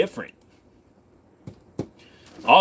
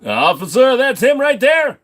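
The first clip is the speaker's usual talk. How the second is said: calling the officer; 5 semitones higher, 7 dB louder than usual. Pretend. Calling police on accent guy.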